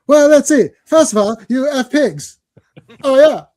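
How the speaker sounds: high, punchy cartoon voice; cartoon Canadian. Cartoon Canadian answers Terrence's complaint, high and mock-indignant. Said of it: "I love the Canadians in South Park".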